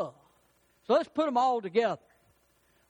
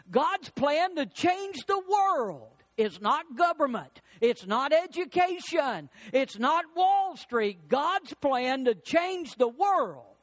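preaching at full force; 5 semitones higher than usual. Acted. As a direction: build the sermon's climax. Preaching build-up.

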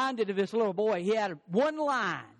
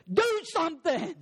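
urgent plea; 9 semitones higher than usual. Retold or imitated. Fiancée urging the speaker.

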